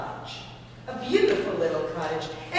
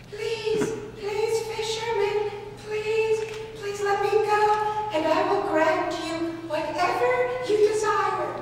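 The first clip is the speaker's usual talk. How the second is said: high tiny pleading fish voice; 13 semitones higher than usual. Acted. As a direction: beg to be let go in a tiny high voice. Golden fish begs fisherman.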